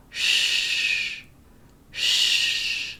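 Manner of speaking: long voiceless "sh" hush; "sh" held as pure air; sh sound demo. Shown as a demonstration. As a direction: push out a steady "sh". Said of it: "move it slightly back".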